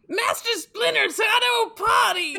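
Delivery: high excited shout. Pretend. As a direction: yell it out. Shouted joke quote.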